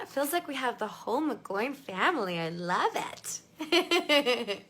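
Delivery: ordinary talk, nothing marked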